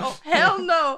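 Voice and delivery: loud, high, laughing imitation of outrage; mock pissed-off outburst. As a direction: play the furious opponent, amused. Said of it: "He was fucking pissed".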